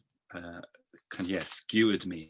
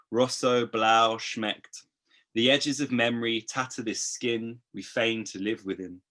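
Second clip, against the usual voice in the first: spoken-word recital, lively; spoken-word poem delivery; poem performance; 4 semitones higher, 6 dB louder than usual. Acted. Performs poem Back on My Bullshit.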